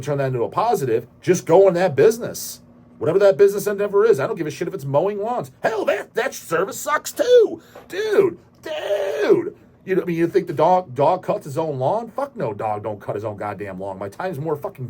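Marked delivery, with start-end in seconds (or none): 5.6-9.6 high, strained, almost crying yell; exasperated yell. Real, not acted. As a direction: wail it out in disbelief. Ranting about lazy service.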